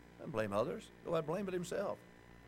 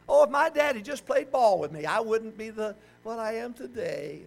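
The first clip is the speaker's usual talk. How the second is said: mock self-pity; 5 semitones higher, 3 dB louder than usual. Mocks a person blaming his father.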